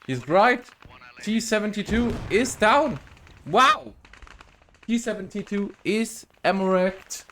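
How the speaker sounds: high, loud excited commentary; excited shouting. Real, not acted. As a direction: shout the kill like a caster. Caster reacts to tank kill.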